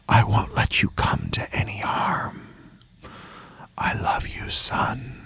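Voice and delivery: slow, tender whisper with long pauses; elusive ghostly voice. A narrator's reading. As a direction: whisper like a father's voice carried on wind. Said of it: "elusive as a face in the clouds".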